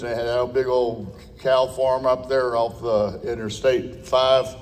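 ordinary talk, nothing marked

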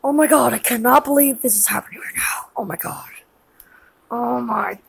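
low, rapid muttering to oneself; under-the-breath shock, fading away; hushed disbelief. Acted. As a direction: drop low and soft, stunned. Stunned aftermath of the freak-out.

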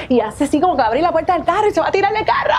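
normal talking voice

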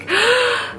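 high laughing gasp of joy; delighted gasp. Retold or imitated. Opening her boyfriend's gift, high laughing gasp. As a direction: gasp like opening the perfect present.

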